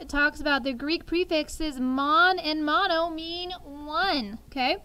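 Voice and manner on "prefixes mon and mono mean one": slow, emphatic. Real, not acted. Teacher stresses the key definition.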